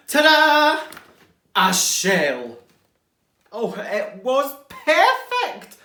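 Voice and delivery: loud, high, delighted reveal; loud joyful reveal; triumphant ta-da. Acted. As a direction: present it with a flourish. Excited reveal.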